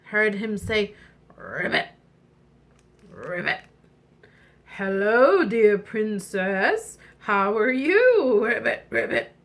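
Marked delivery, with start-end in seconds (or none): 1.4-3.6 low frog croak; deep little ribbits. Acted. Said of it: "and the frog". 4.6-9.3 stretched words from a storybook frog; friendly frog. Acted. Frog greets the princess.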